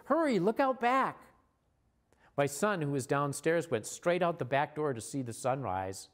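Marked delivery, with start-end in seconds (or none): 0.1-1.2 high, excited retold shout; urgent call to kids. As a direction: call out urgently, pitched well up. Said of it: "I shouted to the kids".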